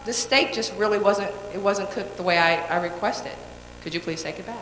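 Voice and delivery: modelling a soft courteous refund request; gentle polite request. Shown as a demonstration. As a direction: soften it right down, no complaint. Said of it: "Versus saying".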